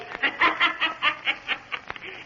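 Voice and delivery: breathy, unvoiced gloating laugh. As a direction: laugh softly at their doom. Villain gloats over trapped men.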